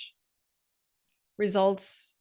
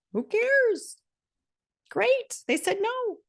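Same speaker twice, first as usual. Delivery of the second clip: high, mocking shrug of a voice; dismissive; 13 semitones higher, slower than usual. Real, not acted. Podcaster brushing off rejection, high and mocking. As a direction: toss it off way up high.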